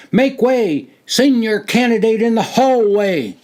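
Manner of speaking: high, loud shouted command; loud demand. A narrator's reading. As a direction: announce the senior like a drill call. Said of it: "a loud, strong voice demanded".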